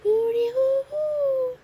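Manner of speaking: loud, high, drawn-out hooting; long, high-pitched holler; yelling. A narrator's reading. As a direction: whoop it out at full voice. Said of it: "yelling at each other".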